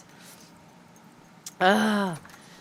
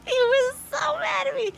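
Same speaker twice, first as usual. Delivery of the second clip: high, loud, slow words squeezed out through laughing; can barely speak for laughing; helpless laughter; 14 semitones higher, 11 dB louder, slower than usual. Real, not acted. Telling the viewer about Beef's anger, helpless laughter. Cracking up retelling the story.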